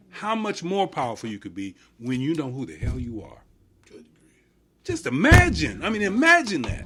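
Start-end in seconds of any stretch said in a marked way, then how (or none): 4.8-6.8 emphatic. Real, not acted. Rousing the group to imagine more.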